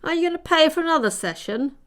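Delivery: high, fast rasping voice; rasping old woman. A narrator's reading. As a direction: rasp it out, impatient. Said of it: "rasped the turtle's voice".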